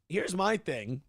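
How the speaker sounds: ordinary talk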